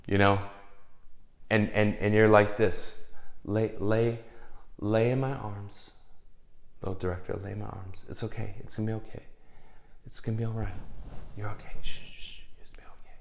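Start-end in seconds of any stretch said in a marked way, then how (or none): 3.4-11.7 put-on cradle him like a crying baby; mock soothing. Composer soothing broken director. 11.8-13.2 whispered shushing; whisper-shush him to sleep; mock hush. Hushing the director.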